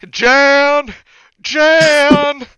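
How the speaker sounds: high, loud searching yell; calling out. Acted. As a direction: shout for someone lost across a town. Imitating searchers calling through town.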